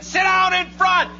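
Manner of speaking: high, slow, drawn-out holler; mad crowd yell. Acted. Angry fans to the man standing up, high held shout. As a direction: yell it like an annoyed fan in the stands. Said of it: "now people are getting mad".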